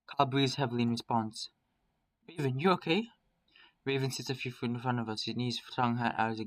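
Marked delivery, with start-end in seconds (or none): 2.3-3.1 high, rising question of concern; raised pitch, questioning; checking in. Acted. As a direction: check on a friend with a lifted voice. Checking on gasping companion.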